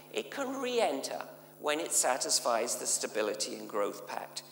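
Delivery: normal talking voice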